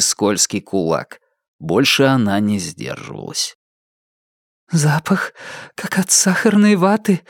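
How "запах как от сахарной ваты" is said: fleeting thought. A narrator's reading. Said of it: "мелькнуло в голове Сары".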